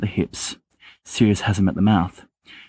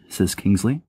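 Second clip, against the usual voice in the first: usual speaking voice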